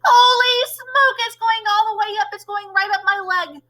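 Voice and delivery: panicking cook. Acted. Cook feeling the mouse climb his leg, high panicked cry. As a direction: yelp as it climbs your leg.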